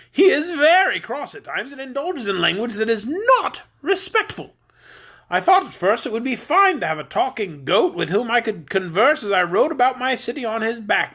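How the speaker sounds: high, jolly voice of a stout king; fat jolly king. Acted.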